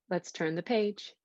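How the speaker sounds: ordinary delivery, nothing marked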